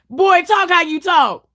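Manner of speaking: ordinary talk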